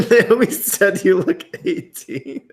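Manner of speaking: slow, high, laughing through it; breaking up with laughter. Real, not acted. Laughing at the age guess.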